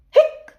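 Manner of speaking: short high squeaky hiccup; spoken cartoon hiccup; little high-pitched hic; mock hiccup. Imitates the monster's hiccup.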